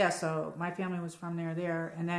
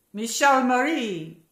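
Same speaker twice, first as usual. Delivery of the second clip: put-on slow, higher and much louder, with an Italian accent; Italian grandmother accent; 6 semitones higher, 10 dB louder, slower than usual. Imitating her Italian grandmother calling her name, Italian accent. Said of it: "that's how my grandmother would say my name".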